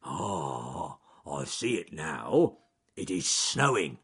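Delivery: lightly voiced slow bear; Charles Growly Bear. Bear spotting the snow, lightly voiced and slow. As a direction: let the realisation dawn slowly.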